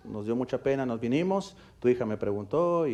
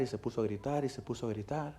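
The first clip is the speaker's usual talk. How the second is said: each repeat softer, sinking to almost nothing; fading repetition; 9 dB quieter than usual. Acted. Preacher recalling the boy's screaming, softer each time. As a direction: let the words die away.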